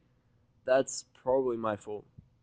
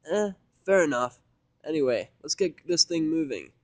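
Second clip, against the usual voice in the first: Vinyl's voice; 6 semitones higher, 8 dB louder than usual. Acted. Vinyl shrugging off insult.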